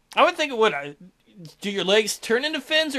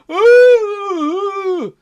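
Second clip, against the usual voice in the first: much higher, louder cry; distant dying cry; 11 semitones higher, 10 dB louder than usual. Acted. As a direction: howl as if eaten. Yells and cries of men.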